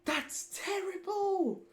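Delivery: high, slow squeal of despair. Real, not acted. Repeats, higher.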